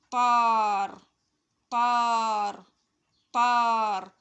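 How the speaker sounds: loud, held single word; loud model reading. Shown as a demonstration. Teacher drilling a word with children, loud model reading. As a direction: project the word and hold its vowel.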